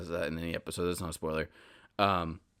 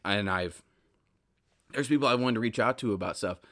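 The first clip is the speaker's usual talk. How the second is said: normal talking voice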